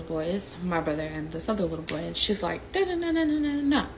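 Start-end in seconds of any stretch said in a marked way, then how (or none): 2.7-3.9 high-pitched mocking babble; mock an angry woman with high, even nonsense syllables; high monotone imitation of someone going off; mimicked nagging. Mimicking a woman yelling, words unknown.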